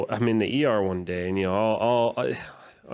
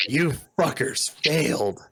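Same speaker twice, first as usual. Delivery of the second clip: threatening rant; 5 semitones higher than usual. Retold or imitated. Patient threatening the bank rep, hammed-up menace. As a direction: promise revenge down the phone. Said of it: "this lady was just like".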